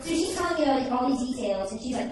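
usual speaking voice

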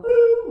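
high, loud hoot; a sudden high, loud "boo!"; imitated boo. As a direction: burst out with a high-pitched boo. Teller mimics mom's sudden reaction.

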